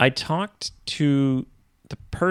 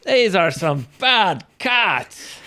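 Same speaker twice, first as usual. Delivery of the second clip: put-on Scottish accent; 8 semitones higher than usual. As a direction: try a Scottish accent on the cats. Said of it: "Scottish accent?".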